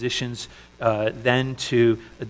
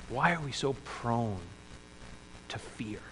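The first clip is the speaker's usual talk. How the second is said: whisper; 13 dB quieter than usual. Real, not acted. Whispered rhetorical question.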